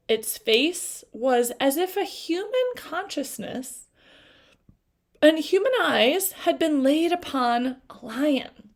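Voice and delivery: ordinary delivery, nothing marked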